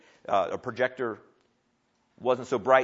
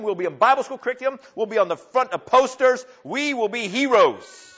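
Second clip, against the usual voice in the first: higher, louder mock bragging; mock boastful; 8 semitones higher, 6 dB louder than usual. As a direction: ham up the dream of fame. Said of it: "They did not look at each other and say".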